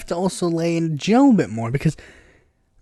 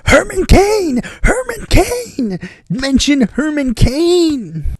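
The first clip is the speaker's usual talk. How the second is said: very high, strained mock yell; retold scream; 8 semitones higher, much slower than usual. Viewer urging Biden to mention Herman Cain, mock screaming. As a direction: re-enact yelling at the screen. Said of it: "screaming at the top of my lungs".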